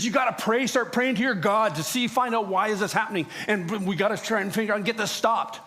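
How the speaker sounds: frightened captain ordering prayer; urgent captain. Retold or imitated. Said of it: "And he says".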